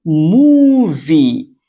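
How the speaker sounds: मूवी held very long and loud; drawn-out model word. Shown as a demonstration. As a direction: stretch the oo in मूवी. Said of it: "इसे पढ़ते हैं". (मूवी को बहुत खींचकर)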